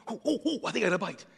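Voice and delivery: high, excited squeals of delight; a hint of a passenger yelping with excitement; thrilled ooh. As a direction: sound like a kid feeling a bite.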